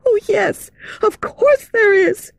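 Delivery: put-on high, girlish frightened cry; little girl crying out. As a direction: cry out like a scared young girl. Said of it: "cried little Meg Giry".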